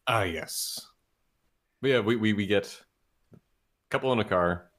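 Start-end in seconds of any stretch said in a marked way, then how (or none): none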